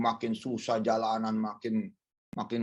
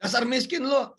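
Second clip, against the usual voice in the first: high, loud jeer; mocking taunt; 14 semitones higher, 5 dB louder than usual. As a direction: taunt him loudly from up high. Mocker in acted dialogue.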